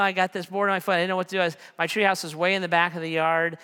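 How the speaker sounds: usual speaking voice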